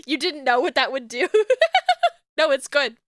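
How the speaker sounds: ordinary talk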